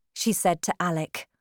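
ordinary delivery, nothing marked

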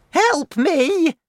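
high, loud cry for help; sudden shout. A narrator's reading. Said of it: "she shouted suddenly".

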